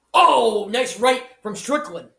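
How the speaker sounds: excited reaction. Real, not acted. Commentator reacting to a right from Strickland, excited. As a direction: react loudly as the punch lands.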